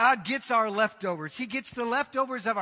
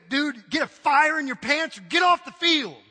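loud, higher coach rant; fired-up coach; 5 semitones higher, 8 dB louder than usual. Retold or imitated. Coach chewing out a lazy player, loud and angry. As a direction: chew the player out. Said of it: "He'd be on fire".

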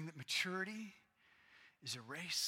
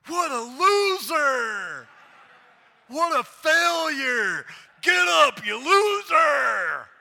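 hammed-up slow scornful shout, much higher and louder; mock yelling parent; 12 semitones higher, 23 dB louder, much slower than usual. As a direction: roar insults at the fallen toddler like a cartoon bully. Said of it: "And I don't go".

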